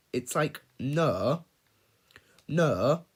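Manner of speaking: normal talking voice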